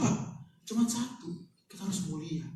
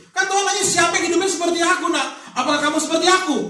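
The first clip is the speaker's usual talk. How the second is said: forceful; 12 semitones higher, 9 dB louder than usual. Pretend. God's real question, emphatic.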